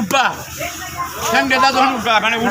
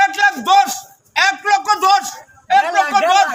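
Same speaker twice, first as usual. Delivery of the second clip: auctioneer; 5 semitones higher, slower than usual. Acted. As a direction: call out the bids like an auctioneer. Auctioneer repeats bid.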